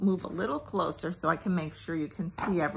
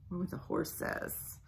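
soft whisper; 9 dB quieter than usual. Acted. Parent reading the sleepy line to the child, whispered. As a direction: hush as it drifts off. Quiet bedtime mood.

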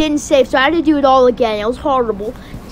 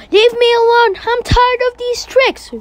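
high, fed-up protest; worked-up, high-pitched exasperation; acted distress; 8 semitones higher than usual. Character fends off hallucinations.

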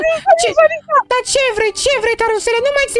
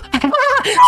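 triumphant laugh; 2 semitones higher than usual. Real, not acted. Victory laugh after winning.

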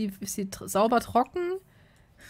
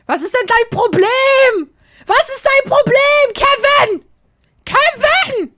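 much higher, much louder angry yelling; furious yell; 14 semitones higher, 12 dB louder than usual. Acted. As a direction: scream at the useless sim in rage. Yelling at the sim Kevin.

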